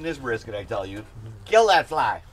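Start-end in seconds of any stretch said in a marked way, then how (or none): 1.5-2.2 loud. Real, not acted. Fly lands near the brisket.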